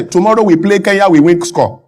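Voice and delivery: ordinary talk, nothing marked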